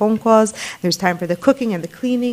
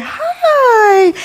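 much higher, louder put-on phone hello; mother picking up a caller's call, much higher put-on greeting; 5 dB louder than usual. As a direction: switch on the friendly phone voice. Mother answering phone on cue.

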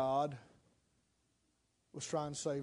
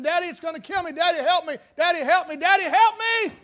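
put-on a little girl's pleas rising higher and louder with each call; panicked scream, building; 10 semitones higher, 11 dB louder than usual. Little girl begs her daddy for help, climbing to a frantic scream. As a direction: start scared and climb to a frantic high shriek. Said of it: "the little girl's screaming".

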